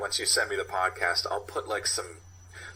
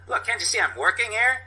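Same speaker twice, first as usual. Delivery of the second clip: high, loud, fast-talking hustler; pushy street vendor; 8 semitones higher, 4 dB louder than usual. Acted. Sasha telling the cop to pay or scram, high and loud. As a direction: brush the cops off like a cornered con man.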